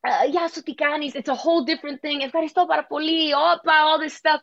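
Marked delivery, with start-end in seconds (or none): 2.2-3.6 a hint of mock Greek; fake Greek. Singer joking she can fake Greek, lightly mock Greek. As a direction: toss off mock Greek phrases. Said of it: "I can fake Greek".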